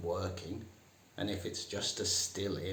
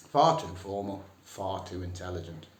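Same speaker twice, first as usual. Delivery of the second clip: usual speaking voice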